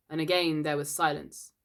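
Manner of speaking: ordinary delivery, nothing marked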